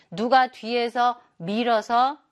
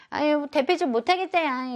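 whining; 6 semitones higher than usual. Acted. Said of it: "이렇게 엄살 부리는 게".

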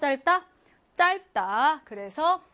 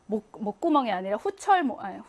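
ordinary talk

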